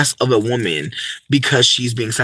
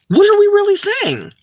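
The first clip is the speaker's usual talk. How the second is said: high-pitched exclaimed question; exasperated, high rhetorical outcry; incredulous challenge; 4 dB louder than usual. Real, not acted. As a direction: shoot the pitch way up in disbelief. Rhetorical challenge after quoted criticism.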